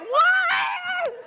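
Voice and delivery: high shouted surprise; startled what. Retold or imitated. Woman told her boob shows, high startled shout. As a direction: yelp it, caught off guard.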